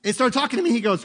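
ordinary delivery

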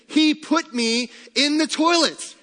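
high, loud and slow, playfully indignant; mock outrage. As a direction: squeal it out like a wronged kid. Outraged reply to mom.

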